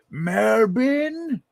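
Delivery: Melbourne? squeaked up high and drawn out; high cartoon Melbourne; high squeaky Melbourne. Shown as a demonstration. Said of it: "It'll make more sense like this".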